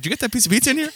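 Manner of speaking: high, curious stranger voice; high mock-innocent question. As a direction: ask it up high, all innocence. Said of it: "I was like".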